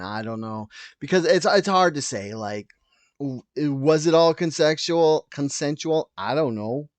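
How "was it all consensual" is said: ordinary delivery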